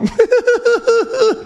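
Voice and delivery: high wheezing giggle; helpless high-pitched laughter. Real, not acted. Laughing at own kissing joke. (fou rire aigu)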